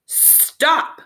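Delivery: sharp, high bark of a command; snapped. A narrator's reading. Turok halting the kids edging away, sharp snap. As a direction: cut them off sharply. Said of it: "Turok snapped".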